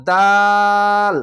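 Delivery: দাল with a very long, level aa; chant-like, drawn-out দাল; three-alif stretch. Shown as a demonstration. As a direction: hold দাল out loud on one note. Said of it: "তিন আলিফ টেনে পড়বেন".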